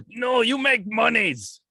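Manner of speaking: high, slow mock Russian accent. Imitating Fedor's handler in a mock Russian accent. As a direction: play a greedy Russian handler in a hammed-up Russian accent. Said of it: "That's my best Russian accent".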